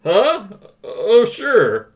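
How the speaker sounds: a hint of a nasal principal; lightly voiced smug school principal. Reads principal Rooney role.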